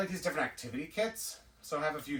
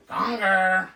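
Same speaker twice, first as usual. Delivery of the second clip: much louder and higher, a gleeful shout of the name Gonger; loud playful call; 6 semitones higher, 12 dB louder than usual. Pretend. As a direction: belt out Gonger like a cheering fan. Calls the Muppet's name in a voice.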